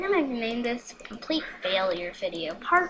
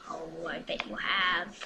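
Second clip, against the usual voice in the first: ordinary talk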